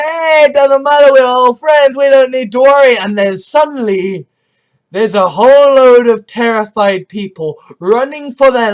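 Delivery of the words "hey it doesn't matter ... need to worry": breezy carefree. Acted. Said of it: "We're just like".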